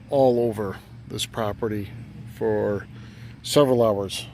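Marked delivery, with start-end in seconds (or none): none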